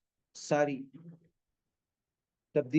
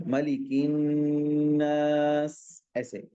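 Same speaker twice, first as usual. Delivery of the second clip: slow melodic recitation; drawn-out chant with nasal ghunna; slow Quranic chant; much slower than usual. Shown as a demonstration. Demonstrates correct recitation.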